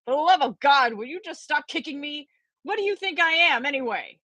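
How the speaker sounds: put-on high, cranky old man's complaint; an irritable professor griping from the dark; crabby old professor. A narrator's reading. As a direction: grumble it out like a cranky old scholar. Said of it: "cried out with a loud, crabby voice".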